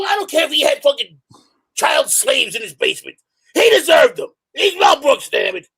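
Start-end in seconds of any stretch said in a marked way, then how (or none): 3.5-5.7 high, loud mock-tearful outburst; mock outraged shout. To the critics defending Mel Brooks, a hammed-up tearful shout. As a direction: wail it in fake outrage at critics.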